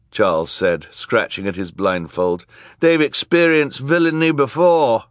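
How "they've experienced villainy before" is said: high, loud character voice; earnest supporter. A narrator's reading. Charles vouching for the children, high and loud. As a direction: vouch for them, voice raised.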